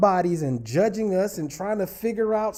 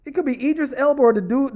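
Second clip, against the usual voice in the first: ordinary talk, nothing marked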